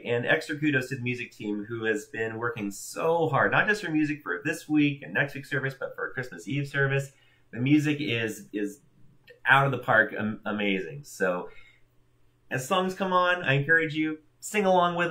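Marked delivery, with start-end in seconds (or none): none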